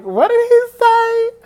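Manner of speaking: very high, slow, loud question; imitated puzzled singer. Singer looks over at yelling fan, high puzzled question. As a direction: squeal it in mock bafflement.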